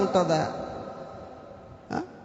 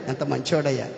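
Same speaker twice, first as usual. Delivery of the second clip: ఎంత మంచోడయ్యా much lower and softer, fading away; soft echo; 11 semitones lower, 7 dB quieter than usual. Real, not acted. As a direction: let the repeat drop to a hush. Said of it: "అబ్బా, ఎల్కానా ఎంత ప్రేమ కలిగినోడయ్యా". (మెల్లగా, తగ్గిన గొంతుతో)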